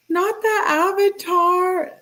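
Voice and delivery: high, squealing laughter into drawn-out words; real laughing fit. Real, not acted. Reader losing it over the avatar detail, laughing. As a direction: crack up, then squeeze the words out high and slow.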